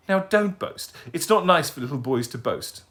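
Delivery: put-on high, prim old lady correcting manners; a fussy grandmother scolding gently. Old lady correcting the owl's manners, high and prim.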